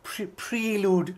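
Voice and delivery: slow drawn-out mock "prelude" as pree-lood; mocking pronunciation. Mocking the scholar's "prelude". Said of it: "Prelude kya hai?".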